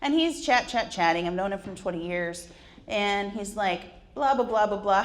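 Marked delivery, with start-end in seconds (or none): none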